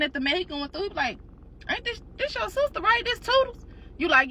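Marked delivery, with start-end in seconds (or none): 1.7-3.6 high, incredulous husband; surprised husband. Retold or imitated. Husband recognizing the man, high and incredulous. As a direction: pitch it up in disbelief.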